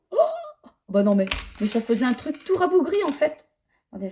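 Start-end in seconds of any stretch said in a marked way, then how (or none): 0.1-0.7 high-pitched shocked "oh"; dismayed gasp. Retold or imitated. Knitter recounting picking up her botched sweater, dismayed gasp. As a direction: let out a high cry of dismay.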